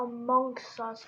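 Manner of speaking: amongst us with a clear S in the middle; slow careful amongst us. Shown as a demonstration. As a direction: stretch out amongst, S sound in it. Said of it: "add a S in the us".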